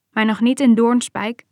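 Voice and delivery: ordinary delivery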